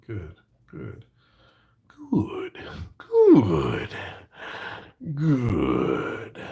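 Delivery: drawn-out creepy "good"; creepy Yoda. Acted. Musing to the audience as Yoda, creepy. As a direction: savour it slowly and eerily. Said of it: "Yoda was like".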